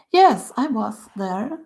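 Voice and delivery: ordinary talk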